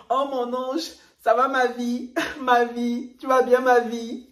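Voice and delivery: high, cooing fake sweetness; mock sweet-talker. YouTuber mimicking over-kind people greeting someone, high mock-sweet voice. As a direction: imitate someone gushing pet names. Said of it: "qui disent toujours".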